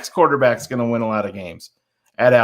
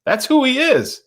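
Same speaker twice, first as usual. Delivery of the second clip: pitched much higher, mocking retort; sarcastic retort; 11 semitones higher than usual. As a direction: toss it back with scorn, way up high.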